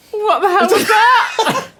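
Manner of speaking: high, startled outcry; shocked. Acted. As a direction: recoil in disbelief. Reaction to parrot.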